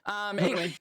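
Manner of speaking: usual speaking voice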